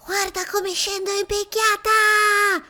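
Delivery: put-on high, loud, slow child's shout. A narrator's reading. Boy calling to his friend from the slide, high and loud. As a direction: call out swooping down the slide. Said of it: "grida Mido".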